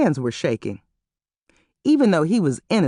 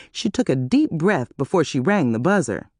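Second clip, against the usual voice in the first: ordinary talk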